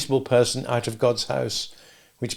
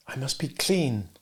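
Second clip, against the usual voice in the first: soft drowsy murmur; half-asleep murmur; 6 dB quieter than usual. Acted. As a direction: say it as if dreaming.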